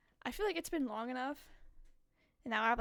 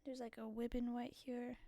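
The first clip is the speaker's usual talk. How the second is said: normal talking voice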